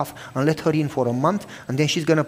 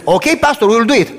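higher, louder eager agreement; mock obedient elders; 6 semitones higher, 7 dB louder than usual. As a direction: chime in like compliant elders.

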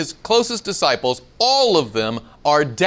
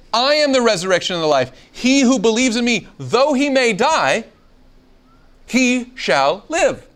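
dramatic scripture reading; 7 semitones higher than usual. Acted. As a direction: read it like a preacher making it land. Reads Jesus' words with emphasis.

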